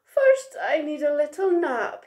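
high yawn sliding into words. A narrator's reading. Big Monkey needs a nap, yawning. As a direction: talk through a big put-on yawn. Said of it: "Big Monkey yawned".

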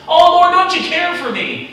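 high, loud whining plea; mock whine. Callous believer whining to the Lord, high and loud. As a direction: overplay the wounded complaint. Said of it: "We can be so callous".

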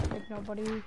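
soft, low teasing; quiet taunt. Real, not acted. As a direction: murmur the taunt under the breath. Taunt said two ways.

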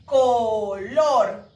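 "Color" as two loud, drawn-out beats, CO-LOR; divided into syllables. Shown as a demonstration. Teacher showing pupils another word, divided into syllables. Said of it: "Tiene dos sílabas".

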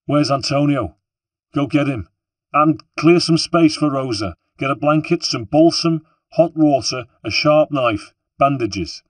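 a gang boss barking commands in a crisis; urgent shouted orders. Acted.

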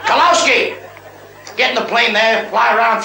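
hammed-up shouted name; calling out. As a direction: summon the sailor across the deck. Admiral calling seaman.

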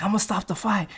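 trainer voice. Retold or imitated. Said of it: "Lightning's like".

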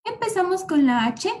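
ordinary talk

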